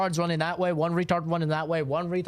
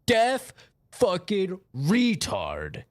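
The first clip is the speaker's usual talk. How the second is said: Oscar raging; 4 semitones higher, slower than usual. Retold or imitated. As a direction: drag out the insult like a toxic teammate. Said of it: "And Oscar's like".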